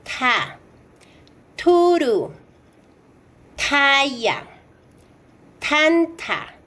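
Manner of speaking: "ta", "Turu", "Taya", "Tanta" with a puff of air after each T; "ta", "Turu", "Taya", "Tanta" as th, an airy T, never English "th"; aspirated T. Shown as a demonstration. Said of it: "El siguiente es".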